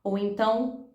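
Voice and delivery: ordinary talk, nothing marked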